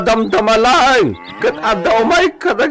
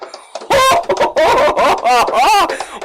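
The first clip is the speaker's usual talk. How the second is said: villain laugh; 3 semitones higher than usual. Acted. Bandit leering at passing lady.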